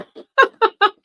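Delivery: high burst of laughter; loud laugh. Real, not acted. Big laugh at own joke.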